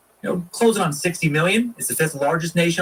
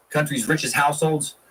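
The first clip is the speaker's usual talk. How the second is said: normal talking voice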